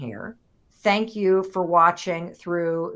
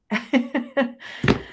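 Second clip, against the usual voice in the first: nervous laugh; 5 semitones higher than usual. Real, not acted. Laugh after overwhelmed remark.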